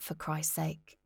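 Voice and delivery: whispered, irritated aside; muttered aside. Acted. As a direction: mutter it under your breath.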